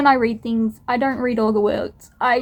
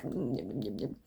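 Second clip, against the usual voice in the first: mock yum; 13 semitones lower, 14 dB quieter than usual. Mock craving voice.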